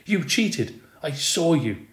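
put-on angry girl yelling cheated at her friend; yelling accusation. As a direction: shout it at your best friend. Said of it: "she yelled".

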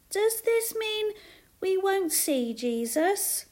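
put-on high, slow small child's question; tearful little girl. A narrator's reading. Little girl asking her mother, tearful child voice. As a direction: ask it slowly in a high, sad child's voice. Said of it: "rubbing the tears from her eyes".

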